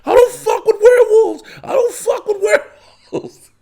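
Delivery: high laughing panic, wanting no part of werewolves; freaked-out werewolf panic. Retold or imitated. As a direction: squeal it like a scared guy refusing a werewolf. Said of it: "Pete Davis is Blackguard is like".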